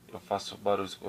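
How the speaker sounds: formal greeting to camera, calm; apresentador de vídeo. Pretend. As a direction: kid doing a YouTuber intro. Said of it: "vou olhar pra câmera e falar". (apresentador de canal, sério)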